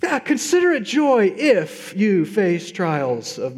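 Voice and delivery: mock wishful rewording, drawn out; wishful misreading. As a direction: read it as the wished-for easy version. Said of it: "Wouldn't it be awesome if it said".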